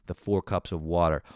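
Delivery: normal talking voice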